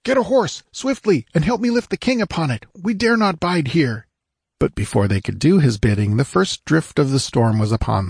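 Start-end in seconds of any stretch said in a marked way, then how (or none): 0.0-4.1 high, urgent commands; raised-pitch urgent call, moderate volume; shouted orders. A narrator's reading. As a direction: order the men to hurry. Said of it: "shouted to the men-at-arms".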